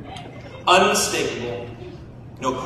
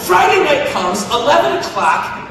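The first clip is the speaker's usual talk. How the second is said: ordinary talk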